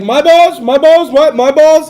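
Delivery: loud, high mocking of an excited co-host; hammed-up excitement; teasing impression of an eager friend; worked-up mimic. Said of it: "He's like".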